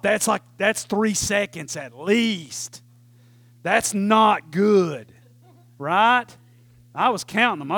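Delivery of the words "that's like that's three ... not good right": ordinary talk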